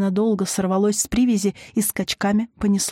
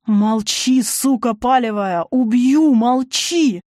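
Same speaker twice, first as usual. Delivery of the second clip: loud, raging attack; anger building to a shout; furious threat; 3 semitones higher, 5 dB louder, slower than usual. A narrator's reading. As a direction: threaten her at full voice. Enraged woman choking her sister.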